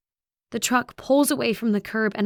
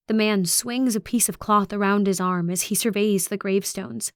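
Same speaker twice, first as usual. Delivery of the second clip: plain reading voice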